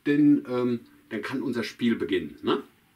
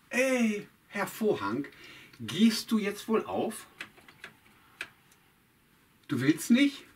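higher, slow, mock-stern telling off; mock scolding; 8 semitones higher, slower than usual. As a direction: scold a stubborn curtain playfully. Scolding the curtain.

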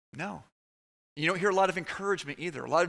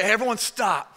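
loud, raised-pitch retold order; commanding shout; 6 semitones higher, 7 dB louder than usual. Youth leader to screaming kids in a dark basement, commanding shout. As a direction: call out to halt the group.

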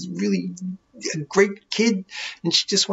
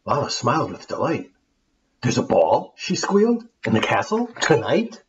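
lively rhyming storybook reading; story narration; 5 semitones lower than usual. A narrator's reading. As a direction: read it like a bedtime tale for kids. Reads the rhyming tale aloud.

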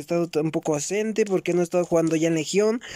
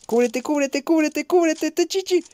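urgent, pitched-up calls to take cover; frantic gameplay yelling; 10 semitones higher than usual. Real, not acted. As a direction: shout it fast at a teammate in danger. Gamer shouting during a firefight. (gritos urgentes en plena partida)

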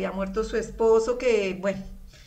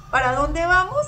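rallying call; 6 semitones higher, 8 dB louder than usual. Retold or imitated. Husband calling to a crowd.

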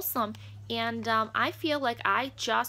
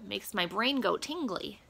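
usual speaking voice

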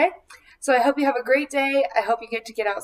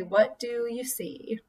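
whisper; 7 dB quieter than usual. Acted. Asks children to look outside.

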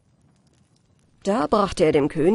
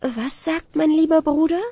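put-on higher, innocent girlish question; young bride asking; 8 semitones higher than usual. A narrator's reading. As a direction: ask sweetly like a trusting young bride. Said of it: "Die Braut fragte".

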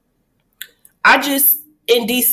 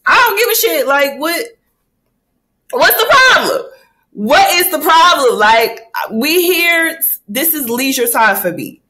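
unbothered sass; 6 semitones higher than usual. Retold or imitated. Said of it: "I'm just like".

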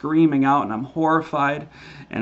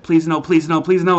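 high, loud begging please no; reenacted frantic plea; desperate pleading; 5 semitones higher, 5 dB louder than usual. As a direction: beg for mercy in terror. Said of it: "and I said".

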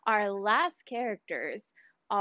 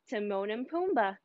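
plain reading voice

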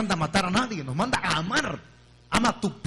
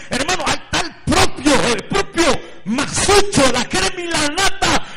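high, loud excited pointing out; alarmed, urgent tip-off; excited warning; 9 semitones higher, 9 dB louder than usual. Retold or imitated. Pastor excitedly points out witch. (pastor alarmado señalando al brujo, agudo y fuerte)

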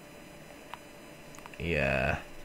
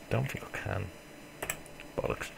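ordinary delivery

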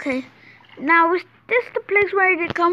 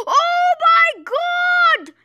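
amazed; 6 semitones higher, much slower than usual. Real, not acted. Amazed at finding huge cave.

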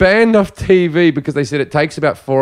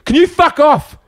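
high-pitched rattled outburst; nervous snap; 11 semitones higher, 3 dB louder than usual. Retold or imitated. Woman turns on the man following her, high nervous outburst. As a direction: imitate her panicky telling-off. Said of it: "she's really nervous now".

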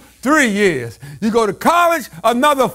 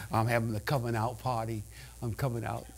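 much lower, very soft mocking voice; mock talk-show guest; 14 semitones lower, 18 dB quieter than usual. Preacher mocking a talk show guest, very soft. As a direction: mimic the guest announcing it.

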